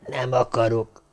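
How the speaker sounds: soft, feeble refusal from a dying man; hushed and faint; weak whisper. A narrator's reading. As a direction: whisper it, with little strength left. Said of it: "de csak suttogni tudott".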